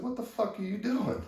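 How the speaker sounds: high, loud angry demand; confronting demand. Retold or imitated. Confronting the superintendent who snapped a picture, high angry demand.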